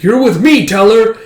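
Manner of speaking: high, loud order; urgent command. Retold or imitated. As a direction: haul him along with your voice. Frankie grabs teller in chaos.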